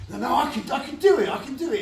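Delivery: high, loud, pleading insistence; eager insisting. Retold or imitated. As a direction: beg to do the stunt yourself, keen. Insisting he can do stunt.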